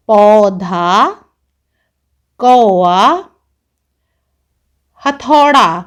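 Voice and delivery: पौधा very slow and loud, every au drawn out clearly; careful model words. Shown as a demonstration. Teacher modelling पौधा, कौआ, हथौड़ा to class, slow and loud. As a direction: pronounce each word loud and deliberate for learners. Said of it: "इस तरह से होता है".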